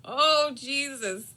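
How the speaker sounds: high, loud, slow groan through laughter; slow laughing oh Jesus; laughing exasperation. Real, not acted. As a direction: drag it out while laughing. Reacting to embarrassing memory.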